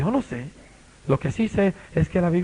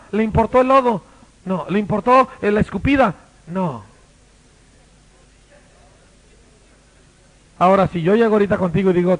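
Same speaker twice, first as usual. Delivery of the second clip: fiery preaching; 2 semitones higher, 7 dB louder than usual. Acted. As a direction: preach it at full force. Preaching at full force. (predicación fogosa a todo volumen)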